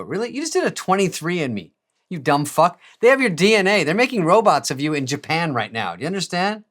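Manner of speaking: incredulous rant. Retold or imitated. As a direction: berate a paranoid friend. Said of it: "I go".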